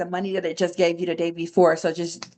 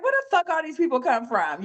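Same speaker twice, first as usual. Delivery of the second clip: higher, startled mock outrage at the new circle; shocked disbelief; 8 semitones higher than usual. Trader retelling noticing a whole new circle, startled. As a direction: replay the moment it hit you. Realizing old friends gone.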